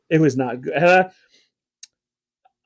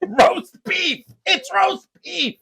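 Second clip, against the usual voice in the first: high-pitched, drawn-out mock exasperation; hammed-up cranky dad; exasperated older dad; 12 semitones higher, much slower than usual. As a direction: imitate an old man stating the obvious. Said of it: "best older person line ever".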